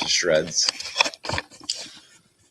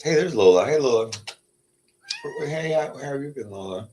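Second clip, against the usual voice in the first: higher, sing-song hello to a pet; greeting the cat; 8 semitones higher, 3 dB louder than usual. Real, not acted. Greeting her cat Lola in a higher pet voice.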